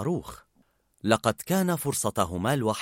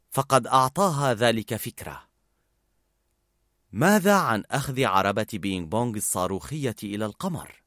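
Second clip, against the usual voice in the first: ordinary delivery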